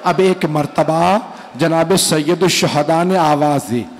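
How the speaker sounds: mournful majlis recitation, steady and drawn; preaching recitation. Acted. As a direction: recite the martyrdom to a grieving gathering. (मजलिस में दर्द भरा बयान)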